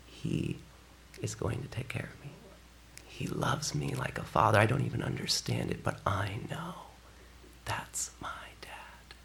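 whispered, tender and awed. Real, not acted. Pastor to congregation, whispered tender awe. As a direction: breathe it like a secret of the heart. Tender hushed reflection on God.

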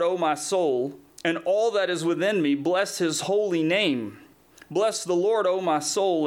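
lifted, measured psalm reading; rhythmic pulpit reading, pitched a little up; scripture reading. Acted. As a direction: proclaim the psalm line by line. Reads psalm aloud to congregation.

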